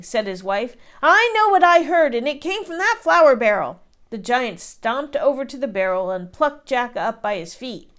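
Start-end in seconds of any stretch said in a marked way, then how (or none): 1.0-3.8 louder, higher accusing boom; loud insistence. A narrator's reading. Giant accuses the flour barrel, loud. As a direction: stab a finger at the barrel.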